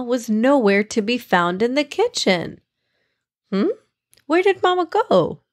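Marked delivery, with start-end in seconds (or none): 3.5-5.4 calling out. Acted. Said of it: "Amani called out to her mom".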